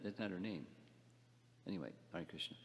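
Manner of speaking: ordinary talk, nothing marked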